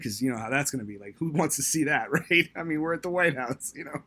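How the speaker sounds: high and loud, laughing through the words. Real, not acted. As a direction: giggle while you tell it.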